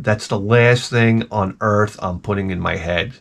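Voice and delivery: ordinary delivery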